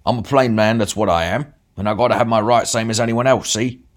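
big man defending his brawl; blustering bruiser. A narrator's reading. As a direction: thump the chest as a plain man. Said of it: "the big man".

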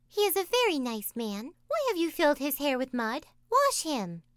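put-on Taffy, indignant child. A narrator's reading. Taffy voiced.

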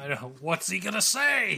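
playful, hammed-up anticipation with the pitch climbing; mock suspense. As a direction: pretend you are hanging on his next word. Mock suspense after co-host's dramatic pause.

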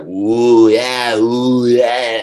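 loud mock crowd cheering and booing; crowd roar imitation. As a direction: belt it out like a roaring arena crowd. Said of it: "this hurricane of sound was like".

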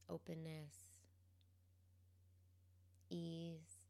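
calm, quiet guidance, leaving space between phrases; guided meditation. Real, not acted. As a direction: gently guide them into the present moment, unhurried.

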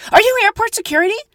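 high, loud, quick demand; mock yelling woman. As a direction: imitate a frantic woman pressing strangers for help. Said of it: "you just yell that in the airport".

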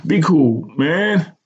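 plain reading voice

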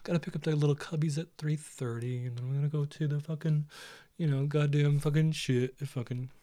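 softly voiced little tiger; a hint of cartoon tiger chatter. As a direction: play a busy little tiger, lightly. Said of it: "imagine a little tiger just going like this".

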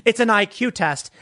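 ordinary talk

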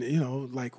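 ordinary talk

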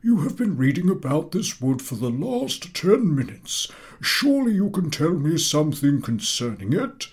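high, exasperated teacher; impatient professor. A narrator's reading. Professor losing patience with the top boy. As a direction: prod the stalling pupil. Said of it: "growing impatient".

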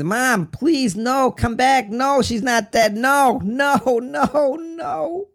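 very high, loud wailing, breaking into a cry; anguished scream. Retold or imitated. Son being pulled from dead mother, wailing. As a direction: scream in denial until it dissolves. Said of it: "I screamed".

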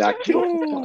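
high-pitched mocking echo; mocking quote. Teasing a friend by repeating his tough boast, mocking high voice. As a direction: parrot the tough line way up high. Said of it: "He said".

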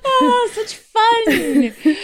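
very high, loud, stretched-out laughing cry; laughing squeal. Real, not acted. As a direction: squeal it through real laughter. Giggling after the Southern Belle bit.

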